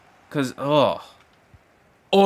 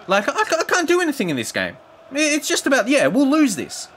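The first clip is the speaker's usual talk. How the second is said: much higher, mocking sarcastic whine; 13 semitones higher than usual. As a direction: sneer it out high and fast in mock despair. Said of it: "when I go with the sarcastic voice".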